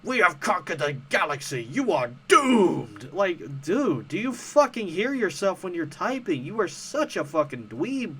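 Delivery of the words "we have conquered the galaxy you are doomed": General Hux impression. Mocking a commenter by doing a loud sci-fi villain. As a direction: announce doom like an imperial general. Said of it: "this is some shit General Hux would say".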